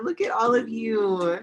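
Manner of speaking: normal talking voice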